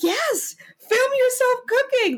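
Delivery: high, delighted, gushing yes; thrilled. Retold or imitated. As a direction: leap at the offer with joy.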